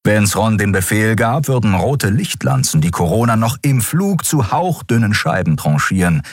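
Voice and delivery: ordinary delivery, nothing marked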